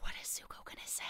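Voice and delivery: hushed, breathless whisper; breathless whisper as the hosts wait on Zuko's answer. Real, not acted. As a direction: whisper it as if holding your breath. Holding breath at a tense moment.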